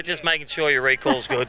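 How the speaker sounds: usual speaking voice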